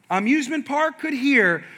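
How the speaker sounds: ordinary talk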